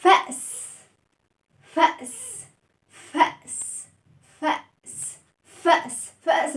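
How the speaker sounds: "فأس" said slowly and clearly, once nearly unvoiced; teaching demo of "فأس"; fa's word demo. Shown as a demonstration. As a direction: model "فأس" for the class. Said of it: "اسمع كده وبص كده".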